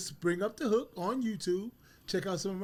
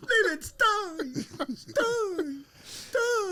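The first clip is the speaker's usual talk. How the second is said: high stretched hype call; announcer shout; 13 semitones higher, 4 dB louder, much slower than usual. Acted. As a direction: call the name like a ring announcer. Repeats intro shouting name.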